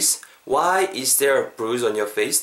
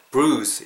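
"bruise" drawn out with firm stress; long stressed word. Shown as a demonstration. Teacher modelling stress, "bruise" said long. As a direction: stretch the vowel of "bruise". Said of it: "이렇게 길게 발음하시고".

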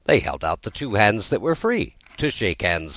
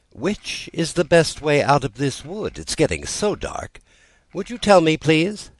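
put-on higher, politely pleading girl; polite plea; 10 semitones higher than usual. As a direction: ask sweetly, rising high on please. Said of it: "Alice said very politely".

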